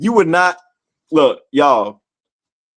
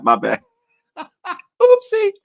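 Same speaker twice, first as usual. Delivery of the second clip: ordinary talk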